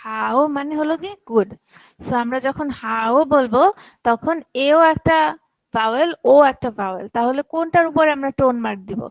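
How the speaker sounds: ordinary talk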